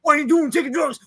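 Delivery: hammed-up anti-drug ad anger; mock angry yell. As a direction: bark at the camera in mock rage. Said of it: "he gets mad at the camera".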